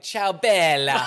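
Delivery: loud, drawn-out mock Italian greeting; hammed-up Italian. Imagining the actress greeting people, hammed-up Italian accent. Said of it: "if she was like".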